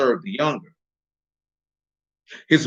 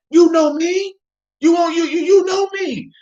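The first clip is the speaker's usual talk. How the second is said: much higher, shouted insistence; desperate pleading; 3 dB louder than usual. Retold or imitated. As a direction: cry out that he must know you. Rejected pleader claiming to be known.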